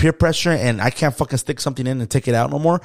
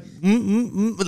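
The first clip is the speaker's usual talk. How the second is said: mock mute humming; 7 semitones higher, 4 dB quieter, much slower than usual. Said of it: "I'll be like".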